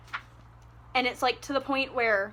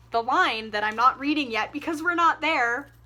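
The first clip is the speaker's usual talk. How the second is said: ordinary delivery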